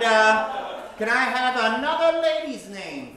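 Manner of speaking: ordinary talk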